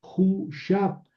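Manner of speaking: "hu, shap" with long high "hu", no "ve"; contracted form. Shown as a demonstration. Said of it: "se convierten en la vocal correspondiente".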